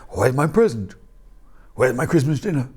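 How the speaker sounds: a dog's grumbly voice, lightly done; pug voice. Acted. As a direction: voice a hungry little pug. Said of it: "That's my pug voice".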